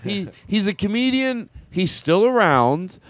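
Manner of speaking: high, slow comic's voice; Jackie Mason impression. Host imitating the comedian, high and slow. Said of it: "he talks like this".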